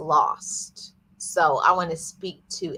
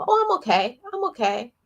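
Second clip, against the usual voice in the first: mock self-reassurance; 9 semitones higher, faster than usual. Brushing off pain, mock self-reassurance.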